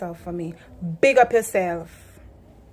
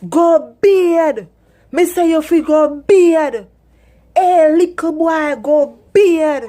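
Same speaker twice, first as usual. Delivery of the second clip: loud, higher shouted Patois, go bathe said go bid; Jamaican yell; 6 semitones higher, 8 dB louder, slower than usual. Retold or imitated. Inner-city parent yelling at kids to wash. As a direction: shout it across the yard. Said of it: "whole heap of noise".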